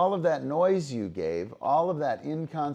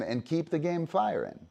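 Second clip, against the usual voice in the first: ordinary talk, nothing marked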